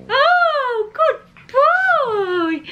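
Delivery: high, drawn-out dog praise; gushing praise. Real, not acted. Owner praising the pug for a high five, gushing. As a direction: coo it high and loud, stretched out.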